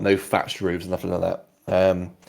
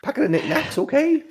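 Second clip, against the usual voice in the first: high, chiding barmaid voice; tavern owner voice. Acted. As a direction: scold him like a prim landlady. Lara voice.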